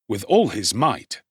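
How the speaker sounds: plain reading voice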